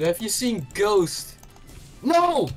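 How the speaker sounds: ordinary talk, nothing marked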